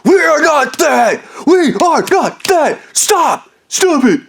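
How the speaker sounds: put-on high, shouted childish tantrum; mock tantrum. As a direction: shriek "stop" like a kid melting down. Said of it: "Just literally screaming and throwing a tantrum".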